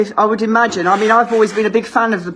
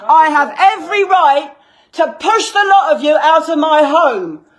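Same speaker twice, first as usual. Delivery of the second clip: shaking with anger; 4 semitones higher than usual. Real, not acted. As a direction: shout them out of the house. Ordering police out, escalating.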